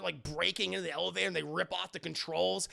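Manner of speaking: ordinary delivery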